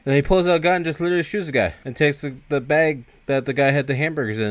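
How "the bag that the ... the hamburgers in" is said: ordinary talk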